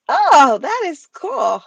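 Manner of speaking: high, slow "Oh, that is cool"; impressed. Retold or imitated. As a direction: act impressed on hearing the twang. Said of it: "you would hear that and be like".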